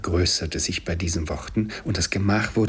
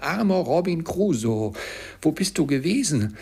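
high, sing-out parrot question; parrot's cry; 9 semitones higher, 3 dB louder than usual. A narrator's reading. Parrot questions Crusoe back from his trip, calling high. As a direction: squawk the question high and stretched. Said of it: "es war der Papagei".

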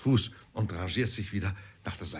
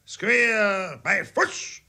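much higher, drawn-out drill shout; shouted command; 10 semitones higher, 3 dB louder, much slower than usual. A narrator's reading. Corporal keeps shouting drill commands, high and loud. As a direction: bellow it like a corporal on parade. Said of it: "schrie er weiter".

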